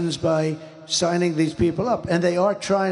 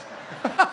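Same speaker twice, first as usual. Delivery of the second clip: breathy laugh; 12 semitones higher than usual. Real, not acted. Laughs at his own point.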